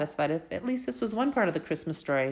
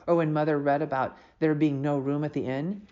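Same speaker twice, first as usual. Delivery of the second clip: plain reading voice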